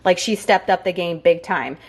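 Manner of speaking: ordinary talk, nothing marked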